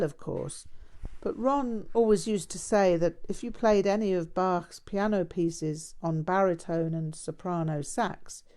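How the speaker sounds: plain reading voice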